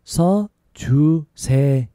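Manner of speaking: one syllable at a time; slowly. Shown as a demonstration. Teacher showing learners another way to ask for writing, slowly. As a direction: take it slowly, pausing between syllables. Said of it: "take it slowly".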